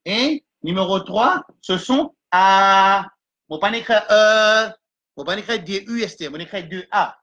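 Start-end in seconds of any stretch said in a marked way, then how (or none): 1.6-3.1 louder, slowed A drawn out; long vowel A. Shown as a demonstration. Teacher showing the long A sound to students. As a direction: stretch the A long. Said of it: "C'est-à-dire le bruit".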